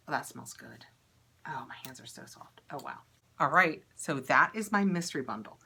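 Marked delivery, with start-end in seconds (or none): 0.1-3.0 whisper. Real, not acted. Quiet reaction to soft hands.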